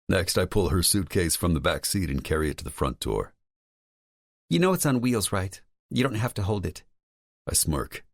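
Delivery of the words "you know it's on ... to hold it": higher, lightly voiced woman; female character voice. A narrator's reading. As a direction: play Lauren, higher pitch.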